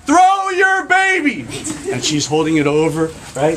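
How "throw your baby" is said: high, loud, slow shout upward; firemen yelling up. Acted. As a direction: bellow up to the lady on the roof. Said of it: "they're yelling up".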